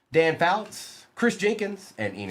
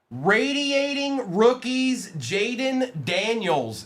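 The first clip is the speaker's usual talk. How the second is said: excited; 7 semitones higher, much slower than usual. Real, not acted. Reading a hit card name.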